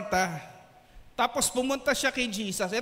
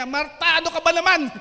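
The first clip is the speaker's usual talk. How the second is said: loud, higher, scolding snap; snapping; 6 semitones higher, 10 dB louder than usual. Pretend. Hypothetical Jesus scolding Martha, sharp and loud. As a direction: bite back at her, irritated. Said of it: "Pero kung si Jesus, sinabi niya".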